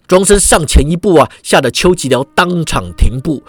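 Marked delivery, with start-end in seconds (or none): none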